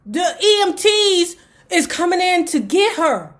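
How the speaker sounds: usual speaking voice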